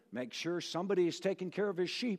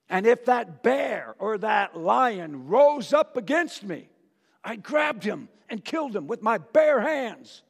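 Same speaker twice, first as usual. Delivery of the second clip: put-on fierce shepherd boy bragging to the king; shouted boast; 3 semitones higher, 6 dB louder than usual. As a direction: proclaim the kill with fire.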